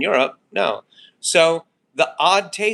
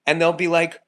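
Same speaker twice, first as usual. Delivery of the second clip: ordinary delivery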